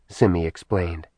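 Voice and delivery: ordinary delivery, nothing marked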